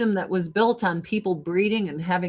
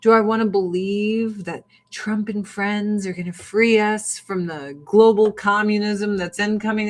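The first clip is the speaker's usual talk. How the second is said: usual speaking voice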